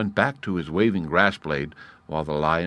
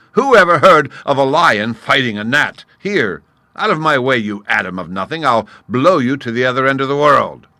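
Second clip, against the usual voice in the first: much louder, higher contemptuous roar; scornful lion; 6 semitones higher, 10 dB louder than usual. A narrator's reading. Lion threatening to blow the gnat away, loud. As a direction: sweep the gnat aside with scorn.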